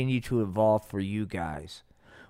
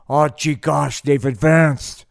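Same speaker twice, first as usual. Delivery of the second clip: loud, slow, put-on goofy voice; gee-whiz dork marveling; dorky Nixon; 8 dB louder, slower than usual. Nixon marvelling at alien tech, loud goofy put-on voice. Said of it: "Dick Nixon was like".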